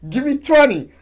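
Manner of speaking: high, loud club call; shouting out an order. Retold or imitated. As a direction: belt it like ordering bottles. What they say in Jersey.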